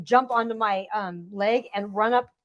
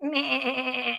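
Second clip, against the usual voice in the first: goat bleat; 5 dB quieter than usual. Retold or imitated. Imitating goat answering.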